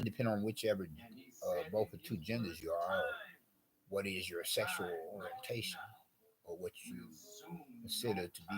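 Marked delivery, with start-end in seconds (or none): 3.9-8.6 whisper. Real, not acted. Aside on a delicate topic.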